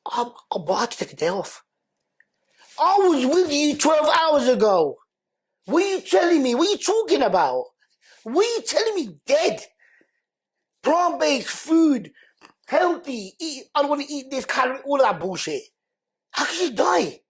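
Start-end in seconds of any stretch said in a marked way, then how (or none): 2.8-9.6 high, stunned disbelief; can't believe what you hear; shocked disbelief. Retold or imitated. Disbelief at death news. 10.8-17.2 incredulous. Retold or imitated. Retold disbelief, high pitch.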